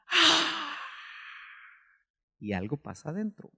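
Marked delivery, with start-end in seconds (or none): 0.1-0.8 high, drawn-out cheering cry; sports fan's cry. Retold or imitated. Preacher imitating someone who loves a sport, excited cry. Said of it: "aquel que le gusta un deporte".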